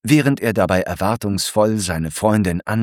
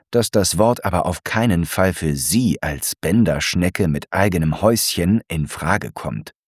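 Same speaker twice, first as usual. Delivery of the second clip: ordinary delivery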